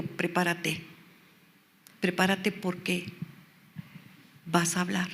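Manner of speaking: soft, low-key instruction with long pauses; the Lord's quiet command. Retold or imitated. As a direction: quote God softly, letting each phrase hang. Voices the Lord speaking to her.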